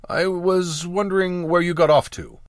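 a touch of nervous covering up; flustered. A narrator's reading. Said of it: "I stammered".

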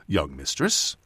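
a deep, stately bow in the voice; very formal. A narrator's reading. Innkeeper seating the girl, very formal. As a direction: announce her like a butler would. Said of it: "said very formally".

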